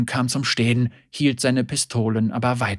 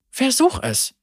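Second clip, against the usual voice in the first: higher, coaxing push; urging Senna; 11 semitones higher than usual. A narrator's reading. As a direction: nudge him on. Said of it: "drängte sie".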